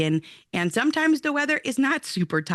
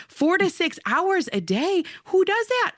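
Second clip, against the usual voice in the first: can't believe what she's hearing; incredulous; 9 semitones higher than usual. Real, not acted. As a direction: pitch climbing higher in disbelief, slow start. Disbelief at Charlotte Mason's idea.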